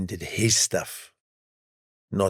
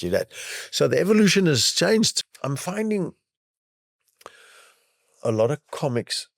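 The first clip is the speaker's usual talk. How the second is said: ordinary talk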